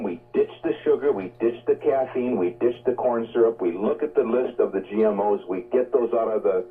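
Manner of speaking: ordinary talk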